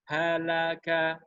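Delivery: Halaka slow with ha, la, ka held equally long; correct even take. Shown as a demonstration. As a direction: model the right way, all beats equal. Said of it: "Harus sama, harus seragam". (Halaka dibaca rata, ha la ka sama panjang)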